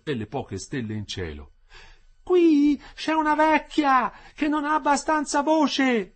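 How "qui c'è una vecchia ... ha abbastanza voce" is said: high, slow, loud announcement; woman shouting out. A narrator's reading. Prisoner's wife calling on behalf of old woman, shouting. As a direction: yell it slowly so it carries. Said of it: "tornò a gridare la moglie".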